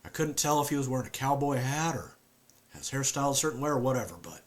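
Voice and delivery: a haunted Southern man; southern accent. Acted. Performs Luke with southern accent.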